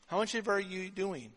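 quiet repeat. Shown as a demonstration.

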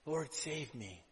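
a whispered-down trusting prayer; quiet prayer. Acted. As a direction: pray it under your breath.

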